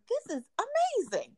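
high, delighted exclamation; excited. Retold or imitated. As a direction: gush with mock delight.

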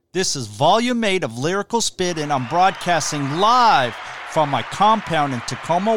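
loud, punchy announcer delivery; big hyped showman's self-introduction; wrestling-style host intro. Acted. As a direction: introduce yourself like a wrestler entering the ring, hitting key words hard.

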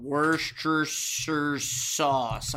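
very slow, drawn-out Worcestershire; sounding it out. Real, not acted. Host looking up the sauce, drawling Worcestershire slowly. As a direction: stretch Worcestershire out as "wurr-sturr-surr". Sounding the word out while searching.

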